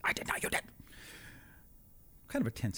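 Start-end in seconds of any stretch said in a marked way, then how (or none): 0.0-0.6 quiet, playful whisper. Retold or imitated. Husband whispers back at wife, playful squabbling whisper. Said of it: "Why are we whispering?".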